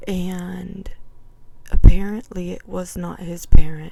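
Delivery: hushed secretive whisper. Real, not acted. Telling viewers the creepy part, whispered. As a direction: breathe it like a secret.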